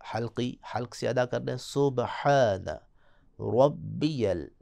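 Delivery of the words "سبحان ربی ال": ربی with a full heavy ر, very slow; slow tajweed demo, heavy ر. Shown as a demonstration. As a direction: stretch سبحان ربی and thicken the ر. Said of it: "را کو موٹا".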